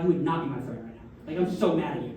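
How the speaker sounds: ordinary talk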